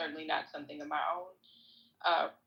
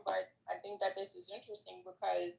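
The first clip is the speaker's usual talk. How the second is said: ordinary talk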